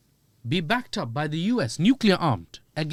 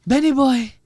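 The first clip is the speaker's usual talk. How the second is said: much higher, loud hammed-up American caricature; Trump; 9 semitones higher, 9 dB louder than usual. President answers his pleading ally, hammed-up loud impression. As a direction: call out in a loud, high mock presidential voice. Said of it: "And Trump was like".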